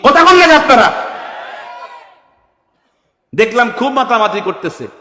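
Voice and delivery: loud, high preaching at full force; fiery preaching. Acted. Preacher rouses the gathering, loud and high. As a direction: hammer the crowd like a fiery preacher.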